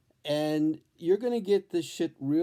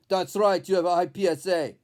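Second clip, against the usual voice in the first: louder, looming doctor voice; Grim Reaper doctor; 4 semitones higher, 5 dB louder than usual. Retold or imitated. Doctor confirms the bad news to the patient, a louder looming voice. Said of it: "looks like the Grim Reaper".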